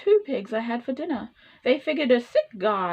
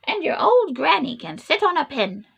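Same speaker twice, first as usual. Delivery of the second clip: pitched up and louder, cheeky; a hint of a bratty pig shouting back; mild mock yell; 5 semitones higher, 5 dB louder than usual. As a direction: yell an insult through the door. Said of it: "the third little pig yelled".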